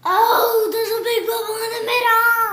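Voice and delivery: loud, fast, thrilled cry; excited squeal. Real, not acted. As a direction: shout it out in delight. Thrilled at slime bubble.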